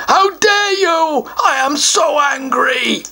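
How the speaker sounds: slow high troll shout; hammed-up troll rage. Troll rages at the child, mock angry. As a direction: stamp and rage like a sulky troll.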